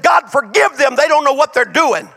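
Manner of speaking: loud, high earnest plea; Jesus on the cross. Acted. Jesus forgiving his murderers, a loud high plea. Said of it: "and he said".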